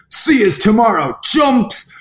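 high cocky yell; mock shout. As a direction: call it out like a swaggering taunt. Swimmer banging chest, shouting.

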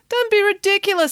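Nanny Piggins dismissive. A narrator's reading. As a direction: brush off the idea in a high, haughty pitch. Nanny Piggins scoffs at bedtime.

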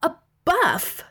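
high-pitched, indignant outrage; worked-up angry accusation. A narrator's reading. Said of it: "he said angrily".

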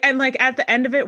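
ordinary delivery

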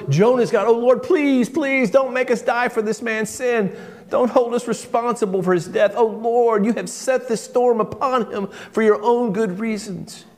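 high, pleading cry to heaven. A narrator's reading. Desperate sailors praying to the Lord for mercy, crying out. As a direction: beg desperately, pitch held high throughout. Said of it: "they cried out to the Lord".

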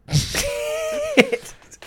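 high squealing laugh; wheezing laugh. Real, not acted. Laughing at his own story.

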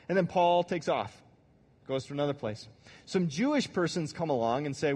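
ordinary delivery

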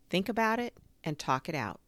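ordinary delivery

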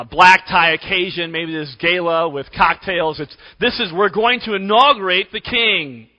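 ordinary talk

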